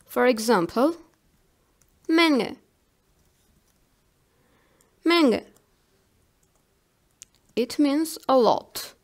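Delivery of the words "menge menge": "Menge" with nasal ng and no hard g; silent g demo. Shown as a demonstration. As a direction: model German "Menge", never releasing the g. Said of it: "want to say the last letter G, but you don't".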